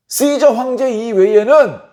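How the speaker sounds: emphatic proclamation. Acted. Chief priests declare loyalty to the emperor, high emphatic proclamation. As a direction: draw it out high as a public protest.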